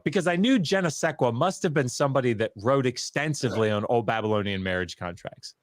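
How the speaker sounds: ordinary talk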